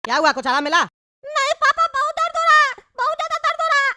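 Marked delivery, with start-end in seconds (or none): 1.2-3.9 put-on high-pitched whining child voice; squeaky kid complaining of a bellyache; high, plaintive cartoon child; little boy in pain.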